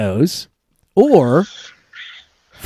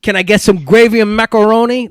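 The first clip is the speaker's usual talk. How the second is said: lightly put-on Italian-American accent, pitched up; a higher voice with a hint of Italian accent; 5 semitones higher than usual. Host fumbles the third quiz line, light Italian accent. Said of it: "Sorry, my Italian accent".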